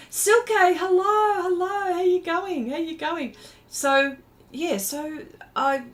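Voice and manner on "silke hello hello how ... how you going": high, bright-eyed hello to a viewer; delighted high greeting to a chat friend; excited greeting. Real, not acted.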